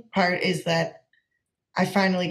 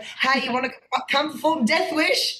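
higher-pitched, badly faked Australian accent; butchered Australian accent; 6 semitones higher than usual. Imitating a friend's invitation to perform, in a butchered Australian accent. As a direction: do a bad Aussie imitation, pitched up. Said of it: "gonna butcher this Australian accent".

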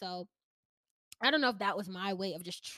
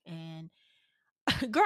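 ordinary talk

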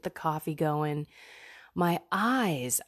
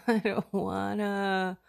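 put-on giggling through a babyish whine; laughing whine; 3 semitones higher, slower than usual. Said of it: "whining like a little baby".

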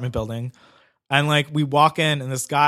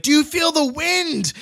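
high, drawn-out mock wonder; stretched, high-pitched freaked-out question; freaking out; 11 semitones higher, slower than usual. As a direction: imitate a tripping friend calling out, pitched way up. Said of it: "freaking out and, like, running and, like, twirling".

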